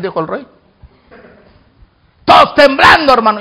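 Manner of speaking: ordinary talk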